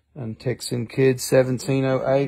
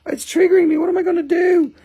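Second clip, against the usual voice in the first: hammed-up panic, pitched way up; mock triggered Amber. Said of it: "She's like".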